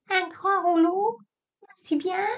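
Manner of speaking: imite la petite voix aiguë en minaudant. Retold or imitated. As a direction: singe sa femme avec une voix aiguë et maniérée. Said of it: "minauda-t-il". (minauderie moqueuse, voix aiguë)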